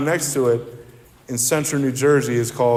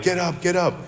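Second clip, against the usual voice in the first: higher, pushed voice telling him to get up; urging friends; 6 semitones higher than usual. Retold or imitated. Friends lifting drunk narrator, higher urgent calls. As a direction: hurry a passed-out friend up.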